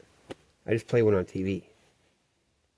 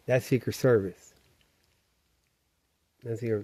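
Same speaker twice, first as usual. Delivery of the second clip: ordinary talk, nothing marked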